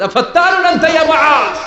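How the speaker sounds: high, loud rebuking shout; scolding shout. Acted. Prophet rebuking Muaz the imam, loud scolding shout. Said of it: "ধমক দিয়ে বললেন".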